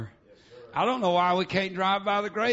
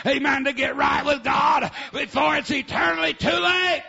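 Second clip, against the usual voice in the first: loud, high, hammering sermon chant with amens; full-force preaching; 8 semitones higher, 9 dB louder than usual. Acted. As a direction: preach it out at the top of your voice.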